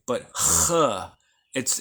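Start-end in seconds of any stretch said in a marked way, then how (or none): none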